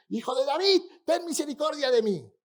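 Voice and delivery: high, loud pleading cry; insistent shout. Retold or imitated. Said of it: "Pero él insistía".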